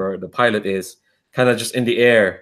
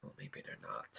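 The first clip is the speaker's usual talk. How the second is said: grim whisper; 30 dB quieter than usual. Acted. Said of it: "they share a look".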